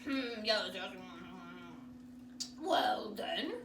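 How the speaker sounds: low, humming doctor; doctor musing. Acted. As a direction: hmm like a thoughtful old doctor. Doctor hums.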